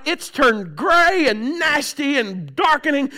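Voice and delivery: ordinary delivery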